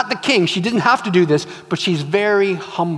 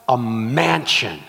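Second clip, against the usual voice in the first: drawn-out wish; 7 semitones lower, 3 dB quieter, slower than usual. Acted. Wife slowly names her second wish.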